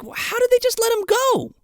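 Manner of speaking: high incredulous question; high mock disbelief. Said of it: "And I'm like".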